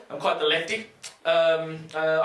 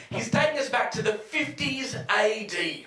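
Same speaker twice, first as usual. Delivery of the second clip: usual speaking voice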